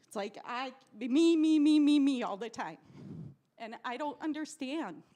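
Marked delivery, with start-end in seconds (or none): 1.0-2.8 loud, mocking chant of me; mocking selfish whine. Mocking greedy people to the crowd, loud childish chant. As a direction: mimic a greedy child grabbing at everything.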